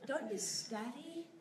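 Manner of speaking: normal talking voice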